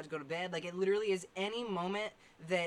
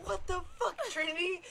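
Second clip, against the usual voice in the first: high mock startled cry; startled at work by Trinity, high yell; retold startled yell; 12 semitones higher than usual. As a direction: jump and call her out.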